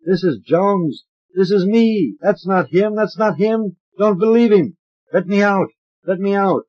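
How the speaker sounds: high, loud frantic pleading; a trapped man shouting from inside another's body; desperate inner cry. A narrator's reading. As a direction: insist on who you are, begging to be let out. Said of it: "Deep hidden voice in me cries".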